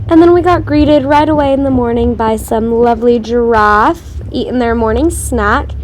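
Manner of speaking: ordinary talk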